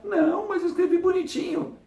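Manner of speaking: hammed-up defensive whine; indignant protest. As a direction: squeak it out like a wounded innocent. Protesting own email was nice.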